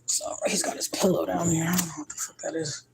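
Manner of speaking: hushed whisper so he won't wake; whispered aside. Real, not acted. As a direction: breathe it out without voice. Hushed aside about sleeping son.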